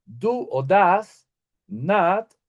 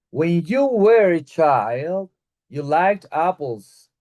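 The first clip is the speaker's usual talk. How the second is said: slow model sentence; 3 dB louder, much slower than usual. Shown as a demonstration. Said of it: "teacher models example sentence".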